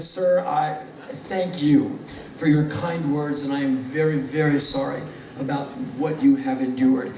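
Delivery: low, measured, earnest condolence; solemn sincerity. Retold or imitated. Comic replying to the weeping man, solemn and sincere.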